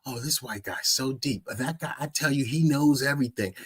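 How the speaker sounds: hushed, awed mock voice of the gullible; breathy starstruck mockery of weak minds. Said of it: "look at that and they go".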